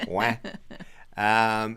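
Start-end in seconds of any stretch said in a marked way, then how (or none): 0.1-0.4 "Ouais" as a broad "weh"; Quebecois pronunciation. Shown as a demonstration. Husband repeating his Quebecois slip, broad "Ouais". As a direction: open "Ouais" out into a loose "weh". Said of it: "I would say "ouais".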